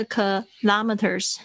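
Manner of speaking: kilometers slowly as kuh-LAH-muh-ters, LAH stressed. Shown as a demonstration. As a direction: lengthen and stress "LOM" in kilometers. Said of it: "重音很明显就是在拉上面". (kilometers慢念，重音明显在“拉”)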